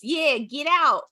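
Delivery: sidekick bear voice. Acted. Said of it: "He goes".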